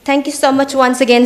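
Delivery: ordinary talk, nothing marked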